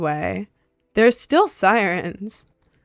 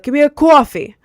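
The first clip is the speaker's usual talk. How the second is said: loud, high, exaggerated New York "coffee"; full New York accent; 7 semitones higher, 8 dB louder than usual. Shown as a demonstration. Demonstrating the running bit, full-on New York accent. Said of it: "I would either do it all the way".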